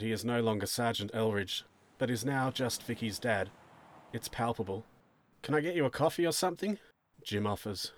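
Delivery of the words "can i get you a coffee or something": quick, higher, hesitant question; tentative offer. Acted. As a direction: offer it timidly to an upset father. Tentative offer to upset father.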